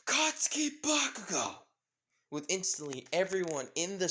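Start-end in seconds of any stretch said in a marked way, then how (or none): 0.0-1.5 high, loud screamed full name; mother screaming down the phone; furious yell. Acted. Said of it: "With then instantly yelling".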